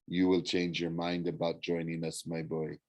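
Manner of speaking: low, soft villain's warning. A narrator's reading. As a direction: caution him quietly, dropping in pitch. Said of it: "Doctor Thorne warned".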